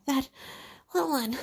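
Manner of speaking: put-on a gasping, short-of-breath boy; gasping Piggy. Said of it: "gasped Piggy".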